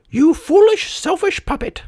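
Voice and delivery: very high, tiny scolding voice; squeaky insect telling someone off; buzzing cricket. A narrator's reading. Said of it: "buzzed a voice".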